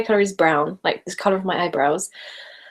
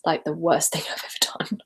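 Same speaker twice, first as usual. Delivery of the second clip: laughing whisper; 3 semitones lower, 5 dB quieter than usual. Real, not acted. Recalling thirteen hour drive.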